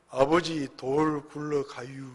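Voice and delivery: slow, soft, drawn-out Chungcheong drawl; slow Chungcheong dialect. Acted. Slow Chungcheong son warning father of a rolling rock, drawling. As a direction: stretch every word like an unhurried Chungcheong countryman. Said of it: "충청도 사람은 말이 느리고".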